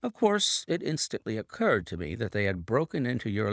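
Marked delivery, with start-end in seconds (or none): none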